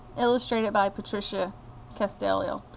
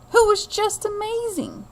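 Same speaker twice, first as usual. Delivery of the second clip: awed, higher lift on the praise; 10 semitones higher than usual. A narrator's reading. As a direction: marvel at the other boy. Admiring the other boy's audition.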